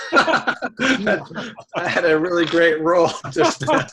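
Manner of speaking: laughing through the words; slow amused laughing talk. Real, not acted. Laughing at his own roll.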